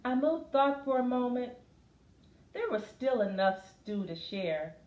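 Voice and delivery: lively, sing-song picture book reading; reading to children. Acted. Teacher reading a picture book to a class, lively.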